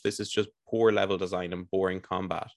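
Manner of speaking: normal talking voice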